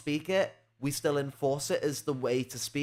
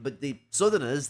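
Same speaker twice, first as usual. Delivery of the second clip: ordinary talk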